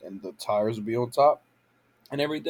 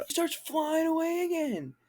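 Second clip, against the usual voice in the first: indignant disbelief; 4 dB quieter than usual. Real, not acted. Outraged repeat of the scene.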